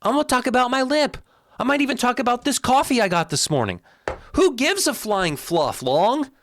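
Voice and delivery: high-pitched, indignant outburst; exasperated rant. Real, not acted. Video host answering critics of long videos, exasperated.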